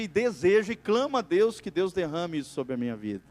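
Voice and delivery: usual speaking voice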